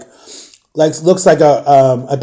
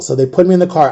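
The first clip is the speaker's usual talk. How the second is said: ordinary delivery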